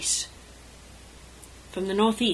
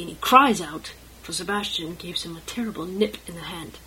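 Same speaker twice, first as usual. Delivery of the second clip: usual speaking voice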